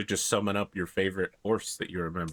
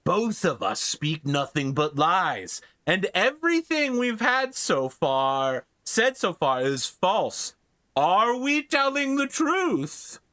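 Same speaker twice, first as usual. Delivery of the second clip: slow, much higher silly troll voice; high squeaky troll; 8 semitones higher, slower than usual. Acted. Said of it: "another troll comes up ... and says".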